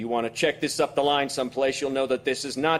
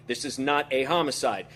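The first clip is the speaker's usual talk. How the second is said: usual speaking voice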